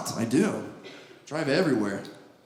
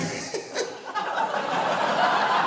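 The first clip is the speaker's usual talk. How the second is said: sheepish laugh; 6 dB quieter than usual. Acted. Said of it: "you honk and then you're like".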